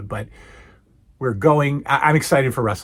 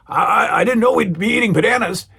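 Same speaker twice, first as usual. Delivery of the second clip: higher, puzzled impression; Hulk Hogan; 7 semitones higher, 4 dB louder than usual. Hogan baffled by Mister T's banana, puzzled impression. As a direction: stammer into it in a lightly raised mock voice. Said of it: "So then Hogan goes".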